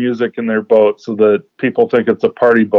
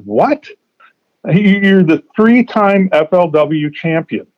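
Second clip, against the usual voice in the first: disbelief; 7 semitones higher, slower than usual. Retold or imitated. Disbelief that champion uses no electronics.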